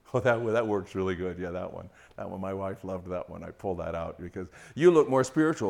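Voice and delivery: deadpan irony, lightly; sarcastic. Pretend. Pastor mocking husbands who quote verses, dry sarcasm.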